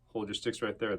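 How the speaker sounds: usual speaking voice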